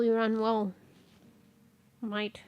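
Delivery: ordinary delivery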